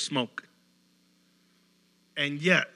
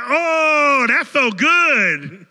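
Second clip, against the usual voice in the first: higher, much louder, slow delighted exclaim; overjoyed gush; 10 semitones higher, 12 dB louder, much slower than usual. Retold or imitated. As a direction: melt with joy at the praise. Overjoyed worker receiving compliment.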